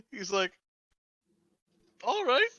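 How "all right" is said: high, halting, uncertain agreement; baffled, hesitant. Acted. Clerk humoring a blood-covered stranger, baffled and hesitant. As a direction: squeak out a reluctant okay.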